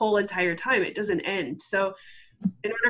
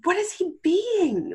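high, slow, exasperated mock question; 11 semitones higher, slower than usual. Questioning her husband's behaviour in the tub, exasperated. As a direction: re-enact demanding an answer of your own hand. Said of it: "holding out my hand and going".

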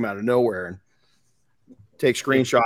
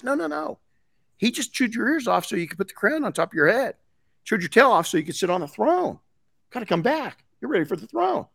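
high-pitched persuading fox voice; coaxing fox; 9 semitones higher than usual. Acted. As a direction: talk the donkey round, pitched high. Fox coaxing donkey in fable.

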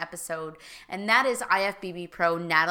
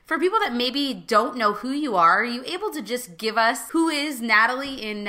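ordinary talk

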